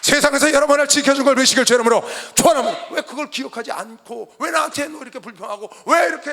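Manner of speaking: loud, impassioned pulpit exhortation; real fervent sermon shout; full-force preaching. Real, not acted. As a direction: drive it home to the whole congregation.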